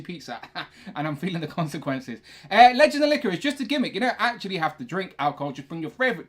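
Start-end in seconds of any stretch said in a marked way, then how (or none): none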